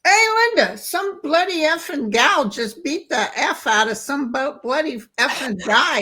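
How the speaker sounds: high, loud imitation of an Irish friend's excited news; bursting out the gossip like an Irish mate. Irish friend Bernard telling Linda about the street fight, loud and high. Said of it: "He was from Ireland".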